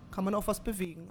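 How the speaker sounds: usual speaking voice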